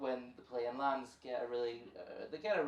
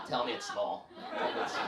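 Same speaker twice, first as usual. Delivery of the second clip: low, breathy-laughing creep; creepy chihuahua; 6 semitones lower than usual. Pretend. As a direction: purr it like a creepy little dog.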